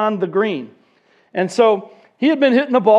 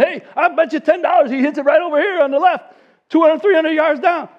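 eager bettor; 7 semitones higher than usual. Retold or imitated. Old guys calling out bets.

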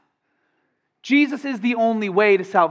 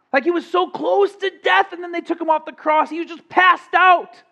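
mock skeptic; 7 semitones higher than usual. Mocking the swoon theory.

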